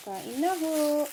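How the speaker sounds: calling out. Real, not acted. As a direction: call out to the viewers. Calls viewers to eat, louder. (masiglang pag-aya na kumain)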